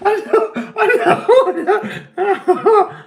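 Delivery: high, loud helpless laughter through the words; can't get the words out for laughing; real squealing laughing fit; laughing hard. Real, not acted. Breaks up laughing over own question.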